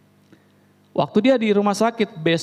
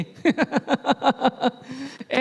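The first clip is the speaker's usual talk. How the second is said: laughter; 5 semitones higher, 4 dB quieter than usual. Real, not acted. Laughter after the retort.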